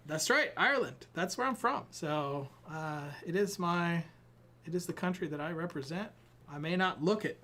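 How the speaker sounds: usual speaking voice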